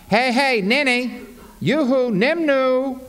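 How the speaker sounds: high teasing yoo-hoo; playful, high-pitched heckle; mock attention-getting call. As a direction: call out mockingly to someone not noticing. Voices the text flagging down reader.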